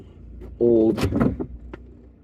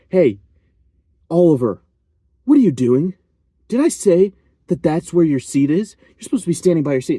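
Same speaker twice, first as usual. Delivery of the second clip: loud, higher mock scolding; imitated teacher reprimand; 7 semitones higher, 5 dB louder than usual. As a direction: imitate a teacher singling out a student. Imitating teacher singling student out.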